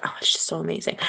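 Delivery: whispered, gushing imitation of mom; breathy airy mom voice; a whispered send-up of mom's gushing; mock gushing mom. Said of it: "she's like".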